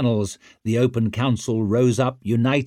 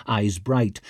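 ordinary delivery

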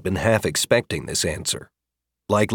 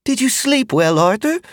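put-on kindly older woman; 4 dB louder than usual. Magda voiced by narrator.

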